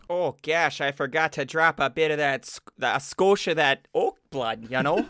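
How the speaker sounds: folksy mock Midwestern twang; hammed-up Upper Midwest lilt; Michigan accent. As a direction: apologise in a homely Michigan accent. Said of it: "like, from Michigan".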